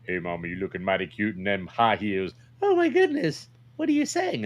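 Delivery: hammed-up puppet squeal.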